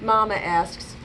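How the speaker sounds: usual speaking voice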